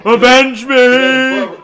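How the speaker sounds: dying hero's shout; slow, loud, hammy last cry; overacted death scene. Red Dawn dying quote.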